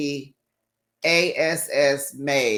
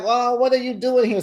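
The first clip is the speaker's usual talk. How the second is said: higher, put-on surprised greeting; mock fake surprise; 8 semitones higher, 3 dB louder than usual. Brian greeting the friend, playing innocent and high. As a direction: play innocent. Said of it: "he like".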